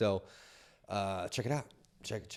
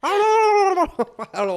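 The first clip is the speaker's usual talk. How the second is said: slow, high gobbling through laughter; gobbling turkey voice; 9 dB louder, much slower than usual. Pretend. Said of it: "The best turkey call".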